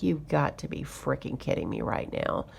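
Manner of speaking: barely voiced, under the breath; muttered disbelief. Stunned muttered disbelief at dealer.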